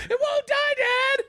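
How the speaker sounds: put-on high, slow whiny kid; squeaky slow child plea. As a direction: whine it like a helpless kid. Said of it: "I'm like".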